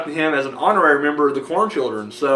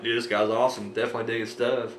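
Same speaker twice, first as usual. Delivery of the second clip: hushed inner thought; 6 semitones lower, 9 dB quieter than usual. Retold or imitated. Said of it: "I'm like".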